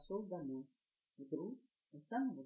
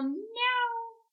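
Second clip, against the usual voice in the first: high squeaky meow; a kitten's little meow; tiny mewing kitten voice; cat meow; 9 dB louder than usual. Acted. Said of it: "опять кто-то сказал".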